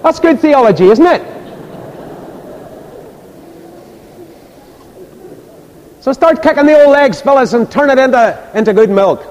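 high, loud exhortation; preaching. Real, not acted. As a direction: ring it out to the congregation. Preaches at full force.

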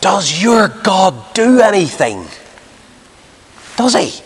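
high-pitched, slow Scottish challenge; Scottish accent. Retold or imitated. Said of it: "in his lovely Scottish accent".

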